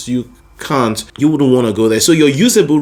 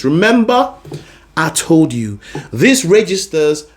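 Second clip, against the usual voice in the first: ordinary delivery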